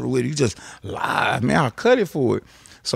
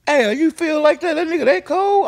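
much higher, louder amazed question; surprised; 13 semitones higher, 4 dB louder than usual. Retold or imitated. Screw questioning Al, amazed and louder. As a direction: Screw amazed at Al's praise. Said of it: "He say".

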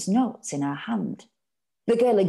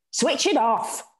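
a touch raised, sneering order; scornful shout; 7 semitones higher, 3 dB louder than usual. A narrator's reading. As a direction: snap it off with contempt. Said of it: "Ma shouted scornfully".

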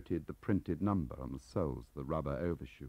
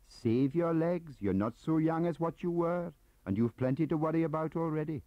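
higher, louder Welsh gossip; chatty, eager Welsh clerk; 13 semitones higher, 6 dB louder than usual. Acted. Gaunt quoting Leo.

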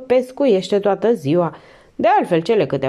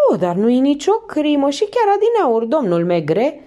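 higher, chatty innkeeper voice; a fussy landlady chattering on; landlady Madame Roy; 8 semitones higher than usual. A narrator's reading.

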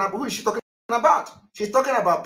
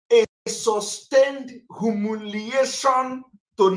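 "sustained" drawn out slowly and pitched up; stress on sustained; 4 semitones higher, slower than usual. Real, not acted. Commentator relaying what God will bring to Nigeria, "sustained" stretched for emphasis. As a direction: lean long and high on "sustained". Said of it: "Underline the word sustained".

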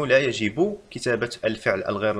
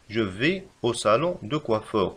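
very slow, each word separate; slow dictation; much slower than usual. Shown as a demonstration. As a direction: dictate the sentence slowly. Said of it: "كما نرى هنا".

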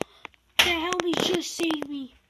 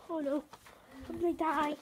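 ordinary talk

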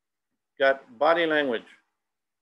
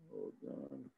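quiet aside; 17 dB quieter, much slower than usual. Real, not acted.

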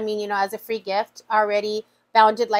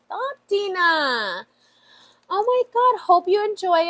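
slow, high, emotional "Stop, Dina"; choked up reading a kind note; moved, drawn-out; touched, slow plea; 6 semitones higher than usual. Real, not acted. Touched by the letter.

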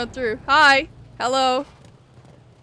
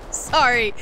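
usual speaking voice

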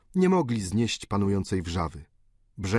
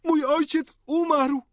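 very high, loud, slow wail of grief; anguished cry; 4 dB louder, slower than usual. A narrator's reading. Countess crying out over her dead father, loud anguished wail. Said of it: "krzyknęła hrabina".

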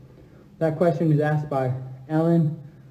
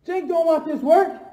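high, louder trash talk; hyped up; 10 semitones higher, 4 dB louder, slower than usual. Real, not acted. Streamer hyped by chat, high taunting trash talk. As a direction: taunt him, all pumped up. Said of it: "you guys got me hyped up".

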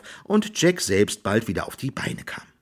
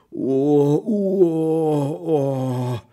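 long, high drawn-out wail; mock wailing moan, held long; 6 semitones higher, much slower than usual. A narrator's reading. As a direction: howl a sustained "oh". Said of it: "heulte Hans".

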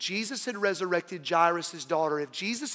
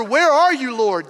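anguished cry; 4 semitones higher, 8 dB louder than usual. Acted. Believer crying out to God.

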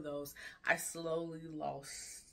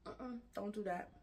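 quick higher-pitched quote of other people; imitating a critic; 7 semitones higher than usual. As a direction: lightly mimic someone butting in, higher. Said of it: "for somebody to be like".

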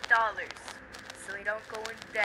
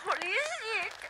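high startled cry; startled exclaim; 11 semitones higher, slower than usual. Pretend. Kid scared by something in the game, high yelp.

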